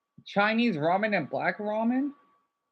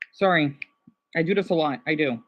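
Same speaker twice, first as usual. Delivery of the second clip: ordinary delivery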